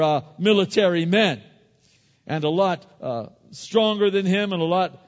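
loud, high, driving preacher's voice; full-force preaching. Acted. Preacher retelling David and Goliath, loud and rousing. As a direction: preach it out to the whole congregation.

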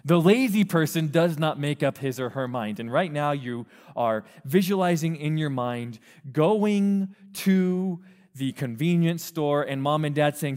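normal talking voice

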